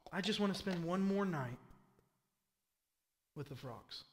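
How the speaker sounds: low, hushed, deliberate understatement; mock-serene Pharaoh. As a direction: drop it soft, with long pauses. Pharaoh's absurdly calm answer, mocked.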